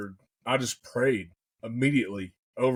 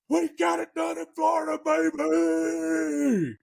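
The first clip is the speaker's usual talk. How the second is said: hooping and hollering; 14 semitones higher, slower than usual. Retold or imitated. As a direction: whoop it out like you just bagged the bird. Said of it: "I started hooping and hollering".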